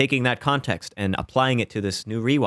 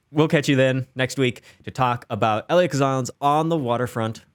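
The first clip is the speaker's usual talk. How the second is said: ordinary talk, nothing marked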